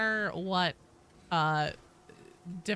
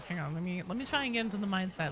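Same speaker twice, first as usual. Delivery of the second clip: ordinary delivery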